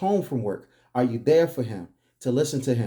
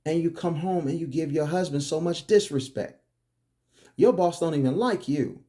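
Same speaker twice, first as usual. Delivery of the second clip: ordinary delivery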